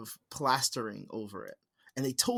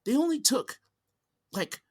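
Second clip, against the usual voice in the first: surprised dentist; 5 semitones higher, slower than usual. Retold or imitated. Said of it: "and he went".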